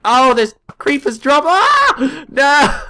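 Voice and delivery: high loud scream of no; panicked cry; terrified yelp at the creeper; frightened. Real, not acted. Creeper attack in game.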